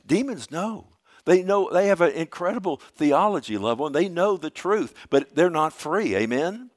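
loud, high-pitched preaching with punched phrases; fired-up sermon delivery; emphatic preaching. Acted. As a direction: drive each point home to the congregation. Preacher at full force.

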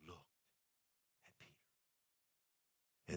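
soft, very slow whisper held on the name; breathless whisper. A narrator's reading. Preacher telling how Jesus looked at Peter, in a hushed whisper. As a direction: let the words barely escape. Dramatic climax of Jesus' look.

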